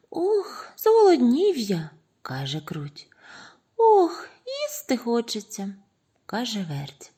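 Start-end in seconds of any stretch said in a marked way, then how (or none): 0.1-1.9 high, loud squeaky whine; hungry mouse. A narrator's reading. Mouse complains of hunger at the pies, squeaky. 3.8-5.7 high squeaky moan, opening loud; hungry mouse. A narrator's reading. Second mouse longs to eat, squeaky.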